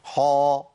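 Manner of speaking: "حا" with a heavy back vowel; heavy, full-mouthed "حا"; wrongly thickened ha. Shown as a demonstration. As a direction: darken "حا" into a rounded "haw". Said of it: "انطق الحاء بالشكل ده".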